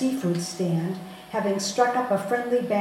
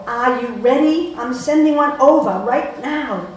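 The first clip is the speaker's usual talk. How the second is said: loud, higher call across floor; 4 semitones higher, 9 dB louder than usual. Retold or imitated. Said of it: "calls from the floor".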